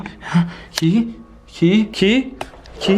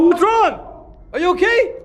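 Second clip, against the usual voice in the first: high, raised call; worried shout; 6 semitones higher, slower than usual. Acted. Checking a friend is okay, worried high shout. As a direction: call out in alarm to someone unseen.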